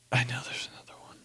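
quick whisper to yourself; hushed, rapid self-talk; whispered musing. Real, not acted. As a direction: think aloud under the breath. Thinking aloud, searching memory.

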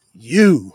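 single out the listener with you; emphatic you. Real, not acted. Preacher accusing the congregation, stressed you. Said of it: "He's emphatically saying".

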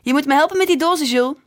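mother calling her son to help, loud and fast; loud hurried call. A narrator's reading. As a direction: hurry him along with a loud call. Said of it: "riep ze tegen mijn rug".

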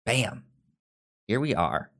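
normal talking voice

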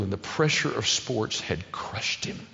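normal talking voice

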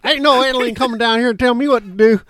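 put-on loud, high, defiant Southern accent; hammed-up Southern local refusing orders. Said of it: "I did a Southern accent".

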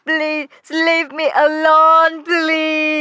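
slow, drawn-out melodramatic begging; distraught plea. Acted. Duyên behind her door begging neighbours, drawn-out distraught plea. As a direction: drag out the plea in despair.

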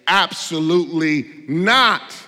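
slow, weighty refusal with NOT hit hard; emphatic. Retold or imitated. Preacher voicing Paul's reply in the Greek, emphatic. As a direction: stretch out "Absolutely not", each word in bold capitals. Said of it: "Paul says emphatically with bold cap letters".

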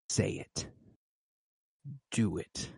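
low, spaced-out whispered dare; imitating a menacing whisper; retold whisper. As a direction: whisper it as a quiet challenge. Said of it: "whispered it to him".

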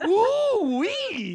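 high squealing ooh wee; excited Cajun whoop. Pretend. Mock Cajun exclamation.

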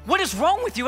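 high, loud irritated snap; angry snap. Retold or imitated. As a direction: snap at the wife again. Repeating the angry outburst.

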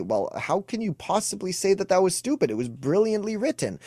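mock indignant retort. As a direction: act offended, defending your taste. Acted defensive retort.

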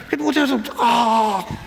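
put-on whiny kid. Kid voice continues, exasperated.

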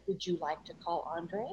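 low, soft imitation of a car's voice assistant; soothing car voice. Car's voice assistant asks the driver, soothing. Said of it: "Because she's so soothing".

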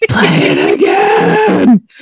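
high, slow shout; playful shouted demand. Pretend. Host begging to hear French voicemail, playful drawn-out yell. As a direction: yell it out, stretching each word. Louder repeat of replay demand.

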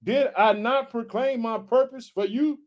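booming preacher reading, high and loud; preaching scripture. Acted. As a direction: proclaim it like a preacher.

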